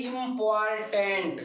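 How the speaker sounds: Important slowly split into im-por-tent; syllable by syllable. Shown as a demonstration. Teacher counts syllables for the class, important said slowly in parts. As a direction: say important in three slow syllables. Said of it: "ये भी तीन syllable का है".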